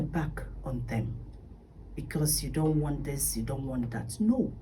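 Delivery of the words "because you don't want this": ordinary talk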